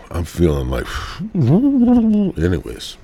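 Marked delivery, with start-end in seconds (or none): 1.3-3.0 long drawn-out sigh of anyways, pitched high; exasperated anyways. Real, not acted. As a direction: give up the topic with a big sigh. Sighing, dropping the topic.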